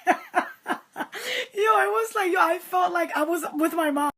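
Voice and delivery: high, helpless real laughter into words; laughing. Real, not acted. Storyteller who felt out with her mom, cracking up. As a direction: laugh your way through it, pitched high.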